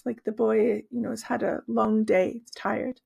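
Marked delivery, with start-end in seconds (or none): none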